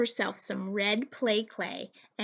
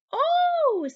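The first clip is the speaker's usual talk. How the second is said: high delighted oh, a little louder; a sudden pleased oh, pitched way up; surprised oh; 6 dB louder than usual. A narrator's reading. Father greeting the surprise cookie, high surprised oh.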